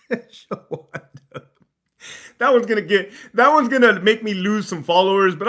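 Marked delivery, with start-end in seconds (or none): 0.1-1.4 name drawn out very slowly through laughter; giggling, stretched name; laughing. Real, not acted.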